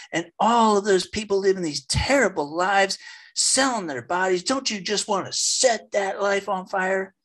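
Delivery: high, rising preacher's fervour as she builds; mimicking a fiery nun. Retelling the nun's sermon at a conference, high mock fervour. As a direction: ham up the nun stoking the crowd. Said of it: "And she says".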